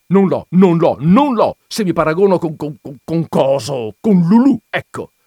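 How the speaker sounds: jealous lover protesting loudly; heated protest. A narrator's reading. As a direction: blurt it out, stumbling over words.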